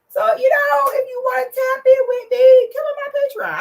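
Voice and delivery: high, loud mock wail; hammed-up pleading. Host begging viewers to join her Patreon, loud mock-tearful pleading. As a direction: whine and beg like a desperate entertainer, playfully overdone.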